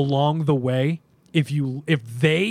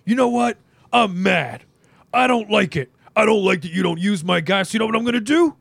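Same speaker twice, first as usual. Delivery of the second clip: higher, louder mock-angry promo voice; exaggerated wrestling-promo complaint, pitched up; Triple H; 6 semitones higher, 3 dB louder than usual. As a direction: put on an indignant, mocking rant. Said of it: "Triple H came out and he goes".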